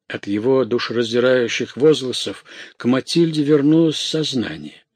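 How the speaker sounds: usual speaking voice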